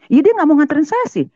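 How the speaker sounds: high-pitched self-justifying comeback; retold indignant retort. Wife answers neighbour about husband, retold indignant retort. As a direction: throw the blame back at him.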